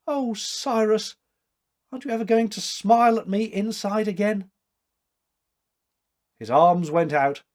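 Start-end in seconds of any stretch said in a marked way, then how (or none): 0.1-4.5 sobbing appeal. Acted. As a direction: beg him through tears, pitched high. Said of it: "heard her sobbing appeal".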